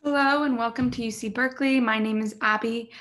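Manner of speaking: ordinary talk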